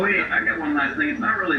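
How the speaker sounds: ordinary talk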